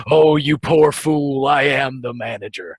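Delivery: all-powerful being revealing himself; godlike cosmic being. Acted. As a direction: loftily pity the mortal, drawing it out. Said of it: "Doctor Manhattan style pose ... and he says".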